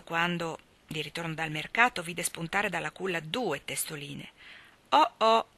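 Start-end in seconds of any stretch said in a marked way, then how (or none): 4.9-5.5 short, raised exclamation; narrated exclamation of mild surprise; exclaiming. A narrator's reading. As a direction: exclaim it, louder and higher. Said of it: "esclamò".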